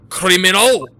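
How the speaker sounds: loud accusation in mock-Russian accent; Russian guy. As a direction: call it out in a bad Russian accent. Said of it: "it's the Russian guy".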